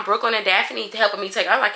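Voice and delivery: ordinary talk, nothing marked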